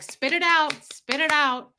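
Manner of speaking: mock impatient prodding; impatient urging. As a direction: hurry someone along, impatient. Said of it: "I'm the person that's like".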